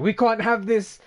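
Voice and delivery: loud, high, hammed-up outrage; mock caps shout. Streamer acting out a viewer's caps message, mock shouting. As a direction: yell it like an all-caps chat message. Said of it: "I love the fucking shouting with the caps".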